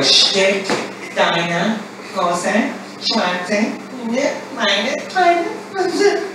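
high, slow German repeated back, squeaking up at the end; high, slow German echo by a young girl; girlish repeat. Retold or imitated. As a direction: parrot it back like a nervous girl learning. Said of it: "we have to go".

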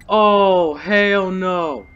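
loud, drawn-out "oh hell no"; big, loud, emphatic refusal at a scare; alarmed refusal. Real, not acted. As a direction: belt it out in alarm. Startled by a game scare.

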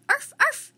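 high, loud little dog yaps; dog barking. Acted. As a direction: yap like an excited small dog. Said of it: "Teddy barked".

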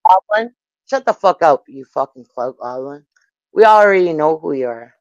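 ordinary talk, nothing marked

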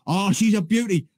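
high-pitched gushing impression of an aviation geek; imitated planespotter's rapture; excited planespotter. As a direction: gush like a thrilled live commentator, accent slightly off. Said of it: "he's got this really strong".

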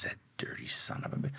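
very soft, whispered swearing; hushed re-enacted disgust; whispered retold curse. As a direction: curse him under your breath. Retold muttered curse about the informer.

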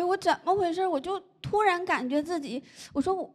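ordinary talk

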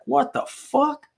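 high, drawn-out baffled question; replayed bewilderment, slow and rising; stunned disbelief. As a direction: stretch it out high in retold disbelief. Retelling his stunned reaction to radio.

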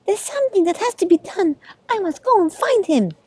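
high-pitched cat announcement; high, determined storybook cat; resolved cat. A narrator's reading. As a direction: declare a mission in a high voice. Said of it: "he told them".

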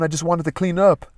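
high, frightened pleading; pleading maid. A narrator's reading. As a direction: plead innocence in fear. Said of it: "she groaned".

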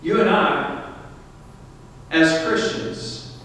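ordinary delivery

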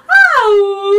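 light up with a sudden idea; delighted oh. Acted. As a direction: dugong brightens up. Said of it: "she brightened up".

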